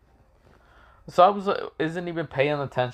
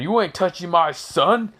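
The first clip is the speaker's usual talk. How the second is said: higher-pitched outraged warning; enraged father; 6 semitones higher, 3 dB louder than usual. Acted. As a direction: flare up in protective anger, pitch jumping. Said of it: "enraged said".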